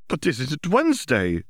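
high, pleading question; desperate. A narrator's reading.

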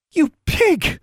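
high-pitched indignant outburst; furious sputter. A narrator's reading. Girl sputtering insults at him, high and furious. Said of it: "she sputtered".

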